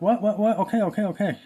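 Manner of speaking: high-pitched, flustered reaction; acted startled reply. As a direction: replay a rattled reaction, giving in at once. Acts own startled reply to wife.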